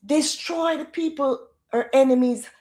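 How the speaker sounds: ordinary talk, nothing marked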